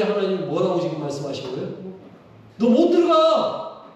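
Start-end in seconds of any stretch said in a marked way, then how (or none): 2.6-3.8 high, loud and slow, a flat refusal; stern God voice. Pretend. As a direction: hammer out the verdict as a stern God. God refuses Moses entry. (하나님이 모세에게 단호하게 거절하며 높고 크게)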